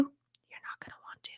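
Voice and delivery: a soft unvoiced whisper, like a secret aside; whispered echo. To a viewer dreading the declutter, whispered. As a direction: breathe it as a private warning.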